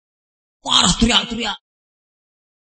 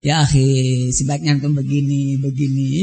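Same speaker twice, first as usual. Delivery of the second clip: much lower, even and measured, as if counseling a brother; gentle soft-spoken advice; 11 semitones lower than usual. Retold or imitated. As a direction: imitate a mild, low, level voice. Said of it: "tapi nggak seperti anak ngomong gini loh".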